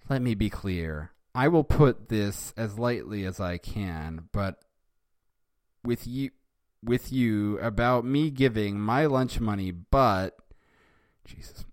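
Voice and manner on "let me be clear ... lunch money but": ordinary delivery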